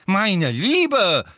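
high, loud scandalised professor; affronted old examiner's protest; indignant professor. A narrator's reading. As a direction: scold in an outraged tone, pitched well up. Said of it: "sagte Professor Tofty in empörtem Ton".

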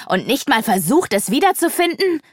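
incredulous angry shout; upset outcry. A narrator's reading. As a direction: let the outrage climb to the last word. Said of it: "rief Sarana aufgebracht".

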